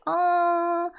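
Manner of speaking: a hint of sugary fake sympathy; mock pity. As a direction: coo oh, poor you with fake pity. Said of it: "I don't want you guys to be like".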